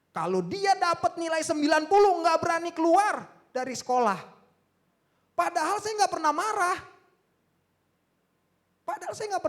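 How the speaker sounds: loud, high, impassioned testimony; full-force preaching. Real, not acted. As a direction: cry the story out to the congregation.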